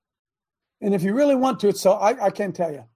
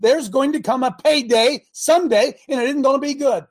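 preaching warning; 6 semitones higher, 5 dB louder than usual. Real, not acted.

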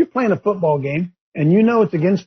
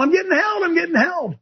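mocking whiner; 11 semitones higher than usual. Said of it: "are yelling".

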